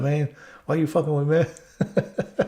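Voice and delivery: amused protest, laughing as you speak. Real, not acted. Laughing at the prank.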